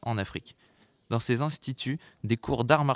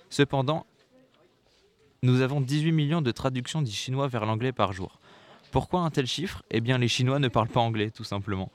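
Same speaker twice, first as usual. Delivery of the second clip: ordinary talk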